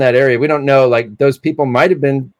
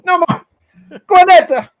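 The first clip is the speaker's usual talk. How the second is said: very high-pitched mock Italian; hammed-up Italian accent; Roberto Benigni; 4 dB louder than usual. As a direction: echo the gag as a despairing Italian. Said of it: "he was like".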